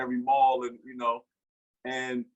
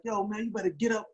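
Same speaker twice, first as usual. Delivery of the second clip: higher, warning edge; teen angrily warning off his father; retold threat; 6 semitones higher than usual. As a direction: tell him to leave, now. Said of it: "Now I'm, now I'm mad".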